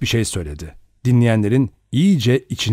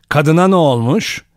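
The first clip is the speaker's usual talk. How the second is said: sharp, louder and higher question; stern, raised-voice questioning; 5 semitones higher, 5 dB louder than usual. A narrator's reading. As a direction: demand it sternly. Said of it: "Emily Brent sert bir sesle".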